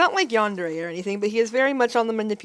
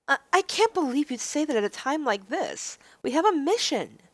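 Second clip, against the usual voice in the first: higher, flustered protest; flustered heroine; 5 semitones higher than usual. Acted. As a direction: push back, embarrassed.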